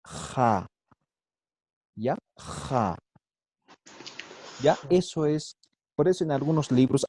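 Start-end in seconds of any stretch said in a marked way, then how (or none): 0.0-0.7 "Ja" with a long rasped fricative J; scraped J. Shown as a demonstration. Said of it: "Por eso se llama fricativa". 2.4-3.0 "Ja" with a long rasped fricative J; scraped J. Shown as a demonstration. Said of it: "Por eso se llama fricativa".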